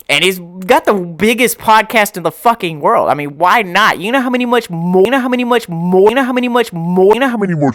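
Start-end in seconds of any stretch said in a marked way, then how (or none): 4.0-7.8 high, excited stammer; can't get the words out, keyed up; excited, repeating. Real, not acted.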